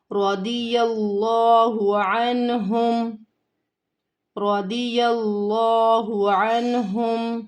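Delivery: slow, drawn-out melodic tajweed recitation; Quran recitation. Shown as a demonstration. Teacher recites the verse for her students, slow and melodic. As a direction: chant each word long and held.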